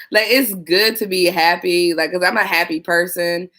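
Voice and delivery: normal talking voice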